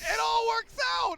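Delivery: huge high shout, drawn out; excited shout. Real, not acted. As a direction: yell it out as the play lands. Caster yells at big play.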